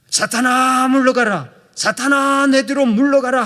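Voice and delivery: high, loud, firm command; the right way, shouting down Satan; resolute shout. Shown as a demonstration. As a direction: order Satan away with total authority. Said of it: "단호하게 대적해야".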